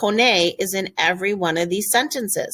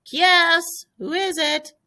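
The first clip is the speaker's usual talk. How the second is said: calling through the door; 11 semitones higher than usual. Pretend. Answering a knock at door.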